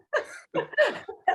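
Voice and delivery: a burst of high, loud laughter. Real, not acted. Laughs about new job.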